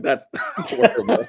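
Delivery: high laughing "that's horrible"; reacting to the bad dubbing, laughing; laughing disbelief. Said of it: "And I'm like".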